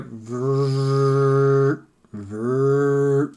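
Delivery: drawn-out buzzing machine noise; machine whirring. Acted. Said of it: "and you hear".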